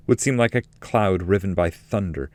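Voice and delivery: ordinary delivery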